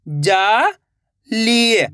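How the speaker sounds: जाली stretched very slowly, each sound held long. Shown as a demonstration. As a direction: drag out जाली for the learner. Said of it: "तो मिलके बन जाएगा". (जाली को बहुत धीरे खींचकर बोलें)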